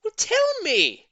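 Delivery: very high, loud, slow demand; eager cry. A narrator's reading. Old lady demanding the secret, very high loud cry. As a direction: cry out impatiently. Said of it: "cried Missus Silver".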